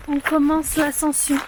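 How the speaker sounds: ordinary talk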